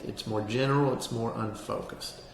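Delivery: ordinary talk, nothing marked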